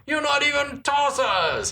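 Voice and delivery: high, slow mock Cockney; overdone Cockney, stretched out high; mock Cockney shout. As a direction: ham up a Cockney thug. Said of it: "bit of Cockney slang".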